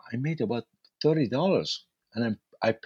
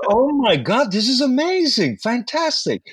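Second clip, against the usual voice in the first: much higher, louder burst of joy; overjoyed; 13 semitones higher, 5 dB louder than usual. Retold or imitated. New cab driver exults over his full van, loud and high. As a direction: shout out the delight of a packed van. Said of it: "I was so excited".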